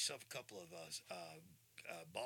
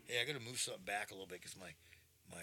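normal talking voice

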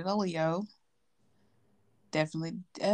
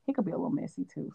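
whispered confiding aside; gossiping whisper about a friend; 6 dB quieter, faster than usual. Real, not acted. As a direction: drop to a secretive whisper. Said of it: "We're just gonna leave it there".

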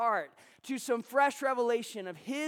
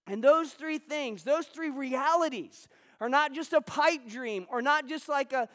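rising preacher's fervor, louder and higher; preaching; 3 semitones higher, 4 dB louder than usual. Acted. Pastor to his congregation, preaching with rising fervor. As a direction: preach it out with mounting conviction to the room. Pastor builds sermon to full force.

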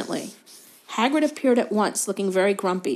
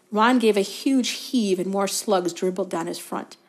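usual speaking voice

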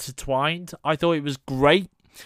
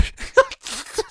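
high squealing laugh. Real, not acted. Cracking up at own impression.

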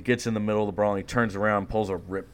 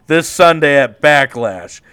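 loud, higher hype announcer shout; wrestling promo; 8 semitones higher, 16 dB louder than usual. Pretend. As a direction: bellow it like a ring announcer. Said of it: "Hell in a Cell match ... at Backlash".

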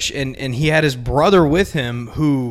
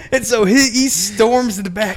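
normal talking voice

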